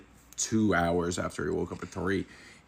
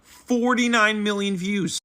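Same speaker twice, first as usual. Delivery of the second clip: amazed; 12 semitones higher, 5 dB louder, slower than usual. Real, not acted. Hyping the huge view count.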